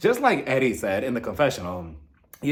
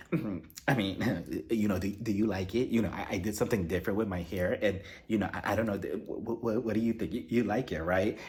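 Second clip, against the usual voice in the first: quiet, hesitant, stumbling for approval; timid insecure Kenya; 5 semitones lower, 8 dB quieter than usual. Retold or imitated. Kenya nervously asking boyfriend about her hair, quiet and halting. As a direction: mumble it unsure, fishing for a compliment. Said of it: "she's like".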